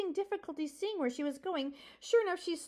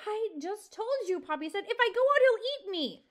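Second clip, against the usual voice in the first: desperate protest; 7 semitones higher, 5 dB louder than usual. Acted.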